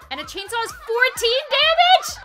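high, loud, amazed exclamation; ecstatic disbelief. Real, not acted. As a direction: marvel at the stats at full voice. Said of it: "I'm so happy right now".

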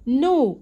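know said as nu with no k; silent k. Shown as a demonstration. Teacher showing pupils the silent k. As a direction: drop the k, say know as nu. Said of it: "والكي سايلنت ما بننطقهاش".

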